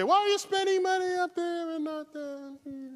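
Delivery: high, whiny voice of the critics; mocking complainer. Lecturer mocking critics in high whiny voice. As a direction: mimic the public's nagging question. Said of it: "So the people say".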